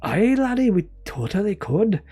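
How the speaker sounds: hammed-up Scottish laddie accent; mock Scottish accent. Streamer answering a viewer's suggestion, joking Scottish accent. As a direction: put on a broad, silly Scottish accent. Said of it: "just doing an accent becomes offensive".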